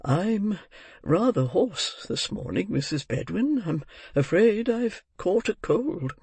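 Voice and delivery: put-on kindly old gentleman making excuses; flustered old gentleman. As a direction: drawl it out, covering up emotion. Narrator voices Mister Brownlow.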